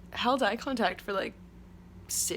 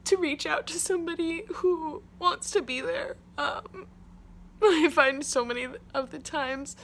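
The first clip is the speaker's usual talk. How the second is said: teary, near crying; 5 semitones higher, 3 dB louder than usual. Real, not acted. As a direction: voice catching with real tears. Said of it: "I'm a little bit sad, clearly".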